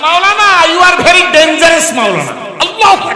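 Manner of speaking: shouted amazement; amazed exclamation. Retold or imitated. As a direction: cry out in awe. Priest exclaims, crowd shout.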